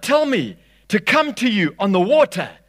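high, loud plea; calling out. Acted. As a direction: call out boldly over wind and waves. Voicing Peter calling to Jesus.

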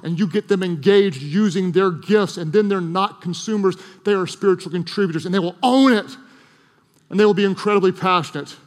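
fervent sermon peak, pressing every line home; preaching at full force. Real, not acted. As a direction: preach it out to the whole room, passionate. Rising climax of the sermon.

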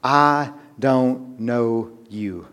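drawn out, quiet final rejection; slow solemn verdict. Acted. Preacher voicing the bridegroom to the foolish bridesmaids, slow and grave. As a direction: let each word fall slowly. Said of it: "What was that response?".

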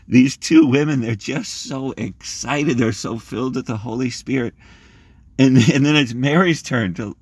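excited. Real, not acted. Preacher excited about the women.

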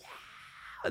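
breathy, soft mock crowd roar; hushed fake audience cheer; imitated crowd cheer. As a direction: mimic a cheering crowd without full voice. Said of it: "Everyone's like".

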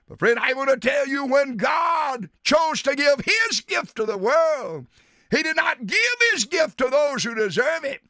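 normal talking voice

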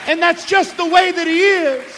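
loud, high revival preaching; preaching at full force. Acted. Preacher rousing the camp meeting crowd, loud and high. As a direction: drive it home to the congregation.

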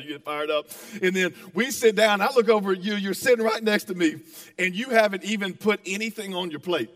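ordinary talk, nothing marked

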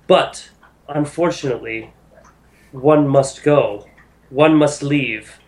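measured, weighty divine address; stately speech with deliberate pauses; solemn goddess. Acted. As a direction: pronounce it like a goddess setting a hard choice. Game master voices goddess Sehanine addressing group.

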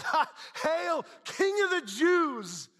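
high, drawn-out jeering hail; soldiers' taunting cry; mocking yell. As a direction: yell it out in mockery, stretching the words. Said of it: "laughing the whole time while they yell out".

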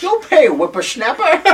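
put-on very high, loud old-man threat; mock old codger. Co-host parodying the too-old Mudd, high cranky codger voice. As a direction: scold in a silly, laughing old-timer voice. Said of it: "He's not old".

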